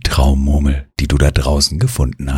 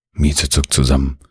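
ordinary delivery, nothing marked

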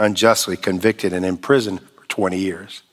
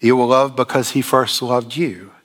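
usual speaking voice

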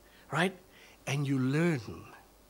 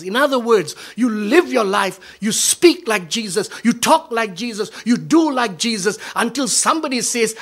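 loud, higher, driving cadence; preaching at full force; 6 semitones higher, 8 dB louder than usual. Acted. Pastor sending the congregation out to make disciples, full-force preaching. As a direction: send them out the door with it.

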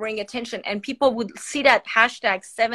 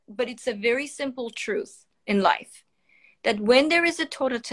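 ordinary talk

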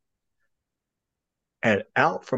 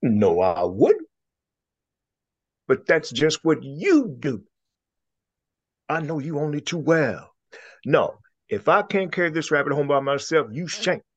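quarrelling alligator; 6 semitones higher than usual. Acted. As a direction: dig in your heels. Other alligator retorts.

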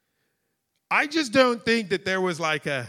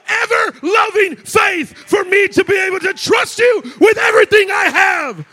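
loud and high, building to a cry of thanks; full-force preaching; 9 semitones higher, 8 dB louder than usual. Acted. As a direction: shout the praise up to the altar.

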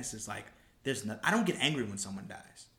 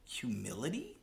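very slow, pausing before a questioning last word; sceptical rereading; much slower than usual. Real, not acted. Puzzling over the tweet's last claim, incredulous. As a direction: draw it out, then query the final word.

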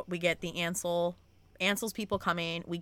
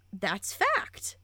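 normal talking voice

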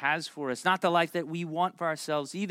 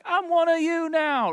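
eager fan; 13 semitones higher, 6 dB louder, slower than usual. Retold or imitated. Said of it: "be like".

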